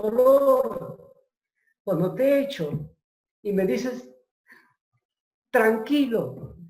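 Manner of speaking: a doctor stunned by a calm patient; astonished doctor. Retold or imitated. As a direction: marvel that he can say 'tranquilo'.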